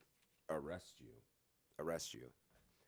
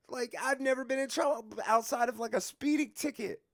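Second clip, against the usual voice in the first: very high, loud indignant yell; retold outraged yelling; 8 dB louder than usual. Refusing to take the blame for a friend, outraged yelling. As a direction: yell back in high-pitched disbelief. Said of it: "he's yelling".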